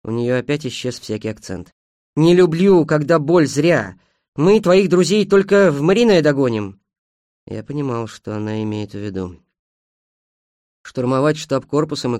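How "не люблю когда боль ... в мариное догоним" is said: louder, raised-pitch bitter protest; bitter frustration. Acted. Woman protests they will catch up too late, loud and bitter. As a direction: complain bitterly, hitting the words hard.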